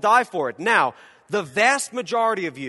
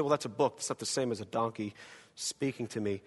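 quiet objection; 7 semitones lower, 10 dB quieter than usual. Retold or imitated. Imagined dismissive listener.

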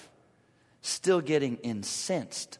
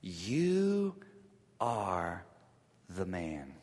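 softer, low, slow words; dramatic pause; 7 semitones lower, 6 dB quieter, much slower than usual. Shown as a demonstration. Nathan accusing David, hushed and low. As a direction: pause heavily between each word. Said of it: "Imagine dramatic pause".

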